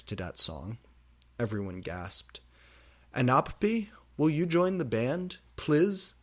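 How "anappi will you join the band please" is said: high, loud pleading; begging. Acted. Band members begging her to join, pleading. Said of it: "begged Lucian".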